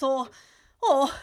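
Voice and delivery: gasping before a sneeze; about to sneeze. Acted. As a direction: sneeze building up.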